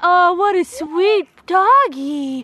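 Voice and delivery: drawn-out sweet dog talk; slow high coo. Real, not acted. Mom cooing slowly at a stray dog.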